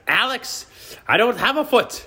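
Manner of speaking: put-on high, laughing Israeli grandma accent; old Israeli grandma, heavy accent. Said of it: "in her Israeli accent".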